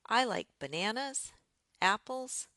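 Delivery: "bananas" and "apples" each lifted high at the end; rising list intonation. Shown as a demonstration. Teacher giving a list of items, rising list intonation. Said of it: "some combination of rising and falling".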